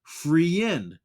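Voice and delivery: friend broken into two syllables, "free-end"; spelled-out wrong pronunciation. Shown as a demonstration. Teacher showing learners the wrong reading of friend, spelled-out pronunciation. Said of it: "that's not how you sound it".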